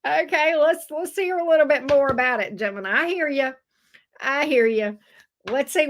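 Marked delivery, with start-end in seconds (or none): none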